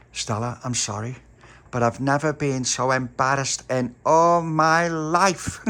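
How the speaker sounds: high, loud, slow scolding; embarrassed scolding girl. A narrator's reading. Pauline scolding Stella after mass, high and loud. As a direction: draw it out, mortified.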